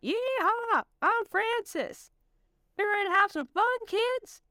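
very high cartoon bird robot; a whooping animatronic cowboy; old rusty robot. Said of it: "called out".

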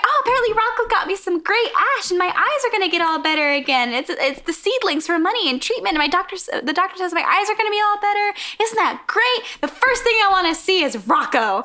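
high, gushing imitation; excited. Stella tells her visitor her eyes will heal, acted all excited. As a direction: rush out the good news in a high voice. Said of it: "so then she's all excited".

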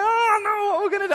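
very high, loud mock alarm; hammed-up panic. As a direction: play the panic up for the kids. Dad's mock panic in game.

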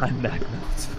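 laughing through a fake confession; giggling while joking. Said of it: "you're the one who is playing".

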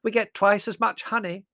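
loud, high-pitched boast; booming aunt's proud voice. A narrator's reading. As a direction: brag loudly and obliviously. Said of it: "said Aunt Fan proudly".

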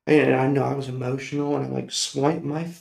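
normal talking voice

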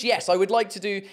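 ordinary delivery

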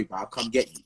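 ordinary talk, nothing marked

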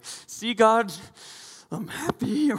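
high, loud fake-cheerful voice; hammed-up forced joy; forced happiness. Pastor mimicking a giver forcing a smile for God, high and loud. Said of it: "you're like".

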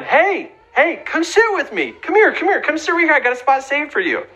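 high, bright calling out; eager invitation. Retold or imitated. Boy inviting the bully to sit by him, high eager call. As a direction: wave him over with eager cheer. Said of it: "here he is going".

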